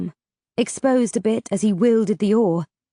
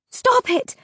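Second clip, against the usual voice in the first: screamed plea. A narrator's reading. Vega begging the ferryman to stop, screaming. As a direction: shriek it to make him stop. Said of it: "I screamed".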